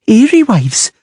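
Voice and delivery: trembling voice. A narrator's reading. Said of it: "in a trembling voice".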